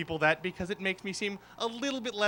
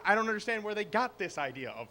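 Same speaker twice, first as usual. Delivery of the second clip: usual speaking voice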